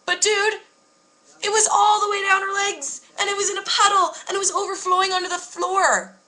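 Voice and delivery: high, wound-up disbelief; excited disbelief. Real, not acted. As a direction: tell it like you still can't believe it. Retelling gross shock excitedly.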